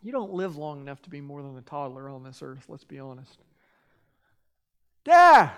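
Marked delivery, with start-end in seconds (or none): 5.0-5.5 loud, high cry of Dad; calling out. Pretend. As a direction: cry out to God like a small child. Believer calling to God as child.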